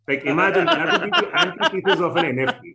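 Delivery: half-laughing at own joke. Real, not acted.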